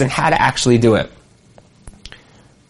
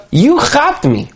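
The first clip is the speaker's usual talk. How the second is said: much higher, slow and heartfelt; being understood; 10 semitones higher, slower than usual. Retold or imitated. Warlord's recognition, slow heartfelt high voice. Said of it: "It was a recognition of".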